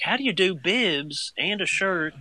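high, slow, flustered question; flustered panic. Retold or imitated. Dad retelling being stumped by the overalls, high and flustered. Retold panic over bibs.

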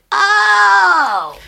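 long, loud, high cry of revelation; hammed-up drawn-out "oh"; dawning realization. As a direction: let the penny drop in a big wail. Acts out the sudden realization.

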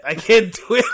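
can barely get it out for laughing. Real, not acted. Giving up on the accent, laughing hard. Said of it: "I'm laughing too".

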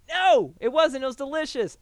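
high, loud emphatic denial; insistent. Retold or imitated. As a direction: insist it was delicious. Retold insistent rebuttal.

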